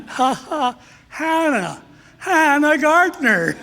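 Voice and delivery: bursting into happy laughter at the reunion; laughing with delight. Real, not acted. Priest turning round to find his old classmate, laughing.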